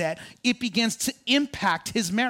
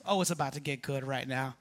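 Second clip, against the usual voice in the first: much softer and lower, a teasing hush; hushed teasing aside; 9 semitones lower, 10 dB quieter than usual. Shown as a demonstration. Preacher teasing the crowd, hushed and low. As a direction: whisper the promise low. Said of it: "repeated line".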